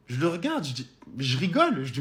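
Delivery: usual speaking voice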